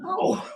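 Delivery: sharp high puppet yelp of pain; a squeaky high "Ow!" from the puppet. Acted.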